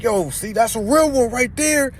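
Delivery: high, loud yell of approval; screaming praise. Retold or imitated. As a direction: shout it across the street, high and slow. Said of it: "He's screaming at the top of his lungs".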